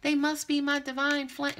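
mock the clients who swear it's their divine flame; mocking the lovesick client. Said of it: "a lot of you is like".

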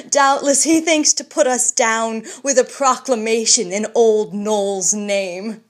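mocking scorn. Bullivant mocking the old man's proclamation, sneering. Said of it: "said Bullivant, laughing".

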